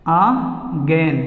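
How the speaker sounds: "अगेन" drawn out very slowly as "aa... gain"; syllable-by-syllable pronunciation. Shown as a demonstration. Teacher showing students how to say "अगेन", slow syllable-by-syllable pronunciation. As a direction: split "अगेन" in two, long pause, stretched "gain". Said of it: "इसको बोलेंगे इस तरह से".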